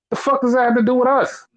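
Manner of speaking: angry rant. Real, not acted. Ranting at the company's excuse.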